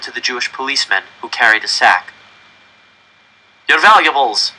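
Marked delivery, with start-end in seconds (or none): 3.7-4.5 put-on loud German-accented command; curt German officer. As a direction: demand it flatly. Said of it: "the officer said".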